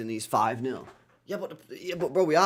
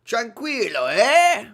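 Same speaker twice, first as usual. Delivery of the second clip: high, loud drawn-out tranquilo; mock soothing Spanish; 8 semitones higher, 8 dB louder, much slower than usual. Shown as a demonstration. Streamer teasing chat to calm down, mock Spanish. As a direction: sing out tranquilo to calm them. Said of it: "need to be tranquilo".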